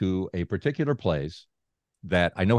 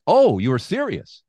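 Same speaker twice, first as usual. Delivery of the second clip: high, loud startled "oh, you were serious"; sudden realization, much higher and louder; mock surprise; 10 semitones higher, 7 dB louder, slower than usual. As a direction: play the caught-off-guard messenger. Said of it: "He went".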